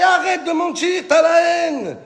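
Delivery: high-pitched comic heckling; mock outrage. Comedian scolding the jealous Miss France runner-up, mock outrage.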